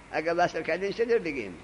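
ordinary talk, nothing marked

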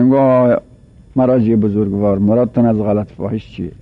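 normal talking voice

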